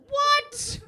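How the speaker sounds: very high, loud disbelief; amazed. Retold or imitated. Comic amazed at a girl eating broccoli, high. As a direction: gasp it out in delight. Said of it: "I was like".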